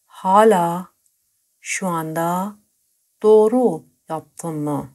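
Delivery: slowly, word by word; slow and broken up. Shown as a demonstration. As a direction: split it into pieces so learners can follow. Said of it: "teacher repeats the sentence piece by piece".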